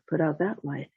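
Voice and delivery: ordinary delivery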